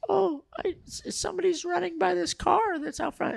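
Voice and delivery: very high, soft falsetto; imitated girl voice. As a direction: mimic her in a tiny thin falsetto. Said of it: "I don't wanna do the voice anymore".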